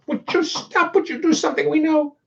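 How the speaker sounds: very high, squeaky pleading voice; exasperated neurons. Acted. Neurons begging the singer for a familiar song, in squeaky exasperation. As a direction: jump far up in pitch, a tiny voice protesting. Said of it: "my neurons are running around".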